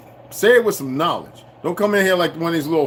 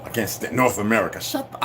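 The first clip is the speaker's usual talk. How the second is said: ordinary talk, nothing marked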